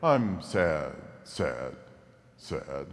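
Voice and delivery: low, sinking mock-gloomy voice; hammed-up blues; mock sad. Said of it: "if you're blue".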